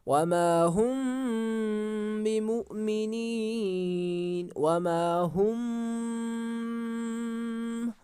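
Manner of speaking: very slow recitation with हुम held long; stretched हुम. Shown as a demonstration. Teacher showing students how to stretch the mim, very slow chant. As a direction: pull हुम out long in a nasal hum. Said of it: "हुम को क्या किया हमने? खींचा".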